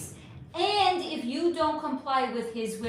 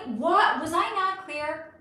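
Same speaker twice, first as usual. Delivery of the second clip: incredulous; 4 semitones higher, 4 dB louder, slower than usual. Retold or imitated. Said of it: "I'm like".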